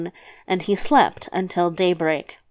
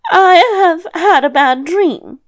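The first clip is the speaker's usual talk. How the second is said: high, louder character voice; grandmother making excuses; 8 semitones higher, 6 dB louder than usual. Acted. Grandmother excusing herself to the devil, high-voiced. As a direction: lift the voice high for the old woman.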